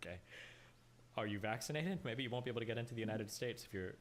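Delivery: normal talking voice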